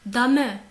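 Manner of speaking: DAME corrected to "da-mè", loud; firm two-syllable "Dame"; sounded final E. Shown as a demonstration. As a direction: stress both syllables of Dame. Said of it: "le E, il n'est pas muet".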